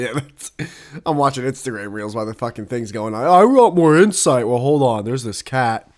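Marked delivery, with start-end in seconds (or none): 3.2-4.5 mock demand.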